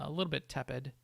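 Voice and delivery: ordinary delivery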